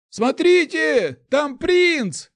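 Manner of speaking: very high, loud, drawn-out call; crying out. A narrator's reading. Onlooker pointing out the prince to the crowd, high cry. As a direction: shout to alert everyone. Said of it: "крикнул кто-то".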